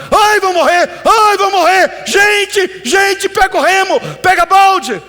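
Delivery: gritos agudos de desespero exagerado; pânico encenado. Acted. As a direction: grite como quem acha que o barco afunda. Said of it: "Jesus levantou apavorado". (gritaria apavorada, teatral)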